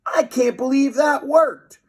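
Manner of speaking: high, loud squeaky amazement; tiny boy amazed the plane flew. A narrator's reading. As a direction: gasp it out in wonder.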